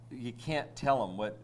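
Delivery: usual speaking voice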